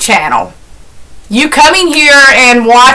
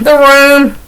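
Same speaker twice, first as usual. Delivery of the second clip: ordinary talk, nothing marked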